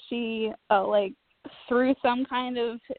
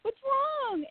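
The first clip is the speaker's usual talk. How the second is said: much higher, frantic pleading; panicked worry; 13 semitones higher, much slower than usual. Retold or imitated. Daughter panics as her mom starts crying, high frantic worry. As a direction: beg to know what's happening. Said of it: "and I'm just like".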